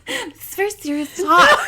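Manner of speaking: real giggly protest; laughing protest. Real, not acted. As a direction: insist it's serious while cracking up. Protesting while cracking up.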